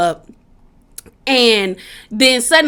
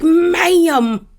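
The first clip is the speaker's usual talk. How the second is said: stern ma'am; 4 semitones higher than usual. Retold or imitated. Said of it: "Sutton was like".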